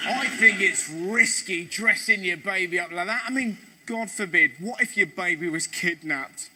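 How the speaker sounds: ordinary talk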